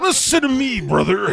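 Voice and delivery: high, loud wrestler growl; Hulk Hogan. Pretend. Friend echoing the dad's wrestler line, loud hammed-up promo. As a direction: bellow it like a pro wrestler promo. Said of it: "the weird Hulk Hogan".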